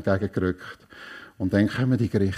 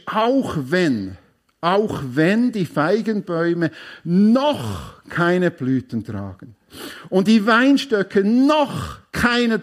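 rising, louder, stretched lines of praise; emphatic psalm reading; 9 semitones higher, 5 dB louder, slower than usual. Shown as a demonstration. Preacher shows the congregation how Habakkuk's praise was stressed, with building emphasis. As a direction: proclaim each "auch wenn" with swelling force. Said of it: "wie, wie, wie die Betonung ist".

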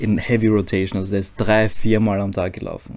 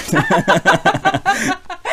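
lautes, kurzes Auflachen; herzhaftes Lachen; 9 semitones higher, 4 dB louder than usual. Real, not acted. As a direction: lach los über den eigenen Witz. Lacht über eigene Pointe. (herzhaftes Lachen)

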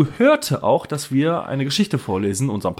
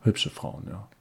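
whispered; 4 semitones lower, 10 dB quieter, slower than usual. Real, not acted. Whispers the answer.

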